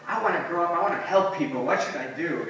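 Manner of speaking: a hint of an earnest kid; eager little niece. Little niece asking aunt what to be, lightly eager.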